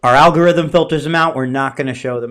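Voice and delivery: loud mocking company line; loud mock quote. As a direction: parrot the company excuse loudly. Mocking Yelp's stock reply.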